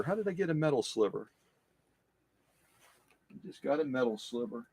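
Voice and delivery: quiet annoyed mumble; pained mutter. Real, not acted. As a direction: grumble under your breath at the sting. Just got a metal sliver.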